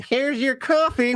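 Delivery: high, drawn-out mocking singsong; snarky. Imitating a hippie barista handing over coffee, snarky. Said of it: "all snarky".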